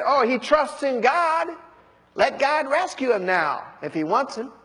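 mocking. Priests sneering at Jesus' trust in God, mocking. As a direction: scoff at his faith. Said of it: "the elders mocked".

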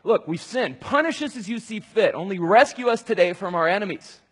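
frightened people crying out to God; panicked plea. Retold or imitated. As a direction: beg God in a rush of panic. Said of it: "They start to panic. They're like".

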